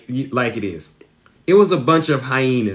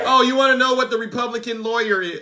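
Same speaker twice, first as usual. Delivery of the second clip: challenging shout; 9 semitones higher, faster than usual. Real, not acted. Challenging viewers.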